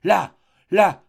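two short, loud, high cries; cry of horror. A narrator's reading. Nadia spotting a head in the ground, cry of horror. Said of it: "un cri d'horreur échappait à Nadia".

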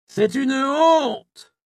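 high, loud and very slow outraged cry; crowd cry. A narrator's reading. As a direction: drag out the shame at them. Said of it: "criaient les uns". (la foule crie, très lent, aigu et fort)

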